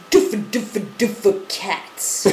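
mouth-made DJ scratch noises; vocal scratch. Pretend. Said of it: "Hit him with another one of your things".

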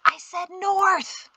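high, slow, strained hushed call; frantic whisper. A narrator's reading. Guide calls after disappearing horse, frantic whisper. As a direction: stretch it out urgently, a held-back yell. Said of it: "Shem frantically whispered".

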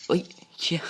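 sudden high yelp of fright; jolted by a jump scare, yelp out high; real startled yelp. Real, not acted. Gamer jumps at a sudden scare, real startled yelp. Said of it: "ตกใจ".

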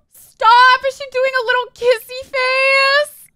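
high, loud gushing disbelief; delighted squeal. Real, not acted. Player sees Sim doing a kissy face, delighted squeal. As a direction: squeal it, thrilled by the cuteness. Gushing over Sim's selfie.

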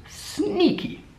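sneaky squeaked very high; high squeaky sneaky. Shown as a demonstration.

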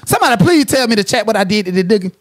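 usual speaking voice

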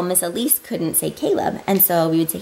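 ordinary talk, nothing marked

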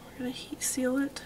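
soft whispered remark while concentrating; whispered aside. Real, not acted. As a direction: whisper it as if thinking aloud.

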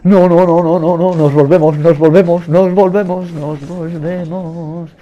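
loud, high panicked repeating; frantic cry that stretches out and eases at the end; panicked retreat. Real, not acted. Panicked retreat from game monster. (pánico, gritando agudo)

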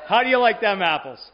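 playful gloat at the class; triumphant crowing. Real, not acted. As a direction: crow it like you just won. Teacher crowing over his point.

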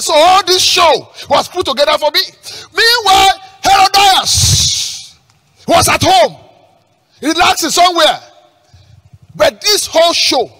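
loud, high sermon cry; forceful preaching in separated bursts. Real, not acted. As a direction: hurl each phrase out to the congregation.

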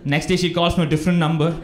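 usual speaking voice

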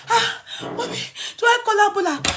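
put-on high, airy frightened girl; lightly voiced panicked daughter; worried daughter. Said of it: "my daughter was crying".

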